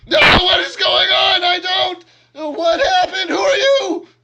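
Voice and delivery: high-pitched, full-out frantic wail; very loud, shrieking panic; panicked screaming. Acted. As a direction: scream in confusion at a sudden attacker. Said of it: "who just starts, like, screaming".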